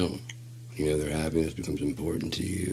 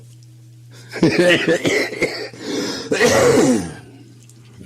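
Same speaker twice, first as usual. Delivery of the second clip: loud laugh; 13 semitones higher, 10 dB louder than usual. Real, not acted. Laughing at audience reaction.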